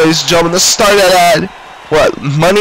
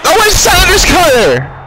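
excited shout; 9 semitones higher than usual. Acted. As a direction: scream the finisher call. Big move in the match.

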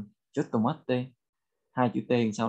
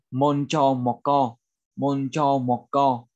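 slow, evenly spaced syllables; slow language model; slower than usual. Shown as a demonstration. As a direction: sound it out firmly for learners. Teacher models Korean phrase slowly. (đọc mẫu chậm từng tiếng)

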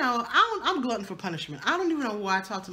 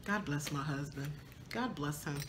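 usual speaking voice

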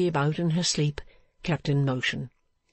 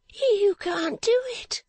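high, slow woman's voice; high-pitched character voice, agitated; distraught strange woman; slower than usual. Acted. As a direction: refuse in a high, drawn-out voice.

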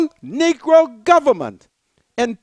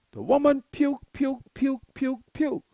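softer with each puke; trailing off into near silence; fading repetition; 2 semitones lower, 8 dB quieter than usual. Acted. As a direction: let the word die away. Repeating puke, fading away.

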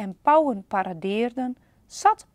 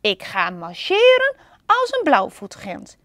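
louder, proud declaration; eager plan; 4 semitones higher, 7 dB louder than usual. Acted. Panda planning to march like a booby, proud and loud. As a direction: announce the march boldly. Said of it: "riep hij".

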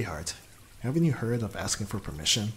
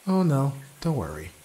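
higher and louder, playful mock reassurance; teasing; 6 semitones higher, 6 dB louder than usual. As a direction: toss it out brightly with a teasing edge. Teasing partner in the bathroom.

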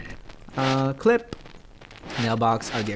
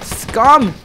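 a higher, louder bark of contempt; shouted insult; 9 semitones higher, 9 dB louder than usual. Real, not acted. Gamer yelling at an enemy in game, a louder, higher insult. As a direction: spit the word at the monster.

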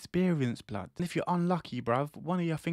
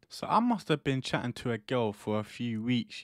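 ordinary talk, nothing marked

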